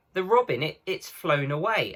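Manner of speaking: excited cry. A narrator's reading. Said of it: "cried Lucy".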